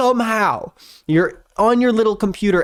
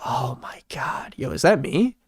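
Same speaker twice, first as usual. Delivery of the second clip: hushed, whispered disbelief; barely voiced astonishment; stunned whisper; 5 dB quieter than usual. Real, not acted. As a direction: whisper it as if startled by what you see. Stunned at seeing a lookalike.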